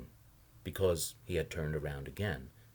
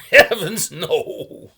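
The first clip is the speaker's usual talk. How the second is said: laughing; 11 semitones higher, 16 dB louder, much slower than usual. A narrator's reading. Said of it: "the last of a guffaw trickling over his words".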